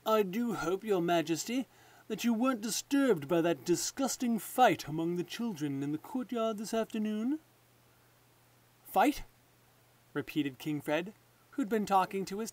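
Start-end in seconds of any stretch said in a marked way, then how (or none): 0.1-7.4 smooth, sly courtier voice, a touch higher; oily courtier. Acted. Lord Spittleworth slyly hinting to King Fred, smooth courtier voice. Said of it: "He cleared his throat, then said". 8.8-9.2 startled question, higher and louder; king caught off guard; surprised. Acted. King Fred startled by news of a fight, surprised rising question.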